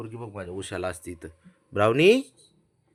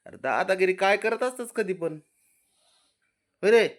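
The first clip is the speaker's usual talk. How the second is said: ordinary talk, nothing marked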